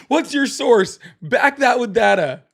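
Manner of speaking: high, laughing mock demand for sources; mock debater, laughing. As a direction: laugh through a pretend debate comeback. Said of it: "throwing out stats and frickin'".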